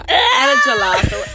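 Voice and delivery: excited boast. Retold or imitated. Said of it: "I said".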